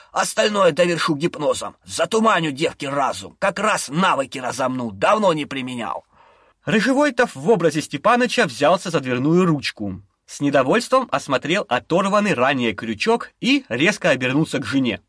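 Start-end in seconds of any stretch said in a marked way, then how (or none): none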